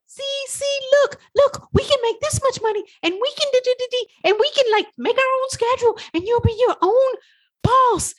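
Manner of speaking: very high, fast pleading sales pitch; coaxing a toddler. Retold or imitated. You coaxing your stubborn brain like a toddler, high and fast. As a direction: wheedle like coaxing a child. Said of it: "You're like".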